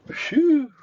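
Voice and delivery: exaggerated sigh of relief; loud relieved phew. Real, not acted. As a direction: blow out the tension. Relief after reaching home.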